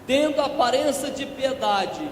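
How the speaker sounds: building preacher's reading, punching each vice; fervent scripture reading. Acted. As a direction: read the list like an indictment. Reads scripture list emphatically.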